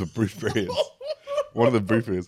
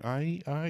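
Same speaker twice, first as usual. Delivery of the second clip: higher, mock moaning aye aye; mock moans; 9 semitones higher, 7 dB quieter than usual. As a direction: imitate her pleasured noise, hammed up. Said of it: "that's her, her noise".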